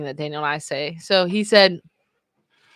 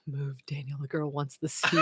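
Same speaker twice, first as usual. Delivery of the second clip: low gruff voice; 5 semitones lower, 7 dB quieter than usual. Retold or imitated. Friend's comment voiced.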